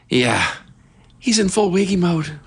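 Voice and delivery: Harper. Shown as a demonstration. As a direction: lift it into a confident woman's voice, badly faked. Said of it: "a female version of Harper".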